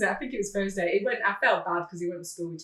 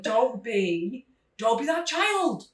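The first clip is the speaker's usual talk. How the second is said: pleading mock warning; 7 semitones higher, 7 dB louder than usual. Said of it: "Swear to God".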